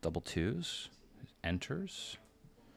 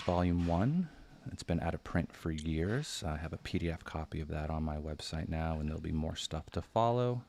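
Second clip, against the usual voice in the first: ordinary talk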